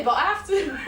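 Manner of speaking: ordinary talk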